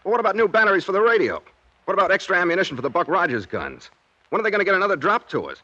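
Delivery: high, fast anxious rush; agitated questions. Acted. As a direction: pile the questions on urgently. Pestering about supplies.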